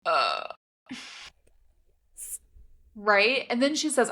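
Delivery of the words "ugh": low swooning ugh; swooning groan. Pretend. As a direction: groan it out, overwhelmed. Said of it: "And I'm just like".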